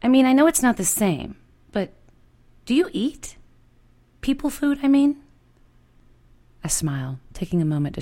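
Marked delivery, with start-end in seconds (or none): 0.0-5.2 put-on high, hesitant girl's voice asking timidly; unsure and tentative, voice pitched well up; nervous young woman pressing a shy question; uncertain young woman. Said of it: "there is uncertainty in her voice".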